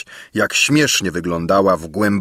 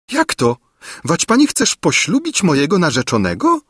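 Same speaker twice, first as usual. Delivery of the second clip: put-on higher, dismayed young girl; cried out; 9 semitones higher than usual. A narrator's reading. Różyczka dismayed at the old woman, raised voice. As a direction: cry out in hurt surprise. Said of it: "zawołała biedna Różyczka".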